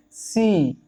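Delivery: सी said slowly with the ee held; सी as one long sustained sound; long drawn-out vowel. Shown as a demonstration. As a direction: stretch the ee of सी. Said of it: "लंबी खींच रही sound".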